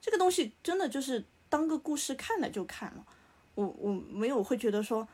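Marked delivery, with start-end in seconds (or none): none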